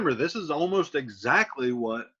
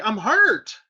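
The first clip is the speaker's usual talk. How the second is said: pained cry; 6 semitones higher, 7 dB louder than usual. Retold or imitated. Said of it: "So he's like".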